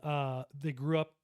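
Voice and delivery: ordinary talk, nothing marked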